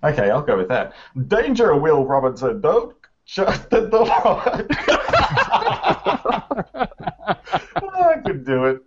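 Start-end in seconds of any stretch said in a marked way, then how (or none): 1.3-4.1 laughing robot voice, pitched high; Lost in Space robot, cracking up. Pretend. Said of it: "have you done a robot voice yet". 4.1-8.8 laughing. Real, not acted. Laughing through robot attempt.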